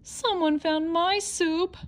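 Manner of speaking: high, slow, squeaky little bear; a tiny cub about to cry; high squeaky Baby Bear. Acted. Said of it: "in her high, squeaky voice".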